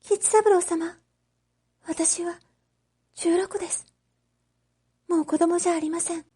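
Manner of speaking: put-on high girlish voice, quick and urgent on the last line; a girl insisting she is grown up, pressing her case; earnest young girl. Oshichi to Kichisaburo at night: high, urgent, earnest declaration.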